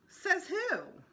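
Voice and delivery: high, sassy challenge; defiant. A narrator's reading. Sheila defying Peter over the rocks, sassy and high. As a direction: snap it back at the boys, high.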